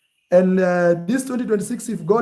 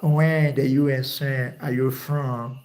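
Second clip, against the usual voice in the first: slow, laboured mock American accent; attempted American accent; 3 semitones lower, slower than usual. Uncle trying to impress American visitor, slow imitation American accent. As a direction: draw it out in a put-on American accent. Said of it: "he's trying now. He's trying to, to meet your standards".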